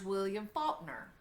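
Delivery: ordinary talk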